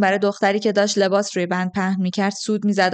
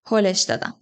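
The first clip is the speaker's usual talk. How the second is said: usual speaking voice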